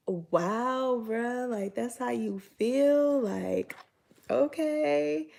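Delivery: mock-offended, drawn-out disbelief; taken aback. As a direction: react like you can't believe he said it. Retold taken-aback reply to insult.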